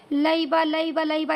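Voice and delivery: لعیبہ gabbled fast, letters run together; rushed wrong reading. Shown as a demonstration. Teacher mimicking a student rushing لعیبہ fast. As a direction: rattle off لعیبہ carelessly, too quick. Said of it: "ایسا نہ ہو کہ بھائی بس یوں ہی جلدی جلدی سے".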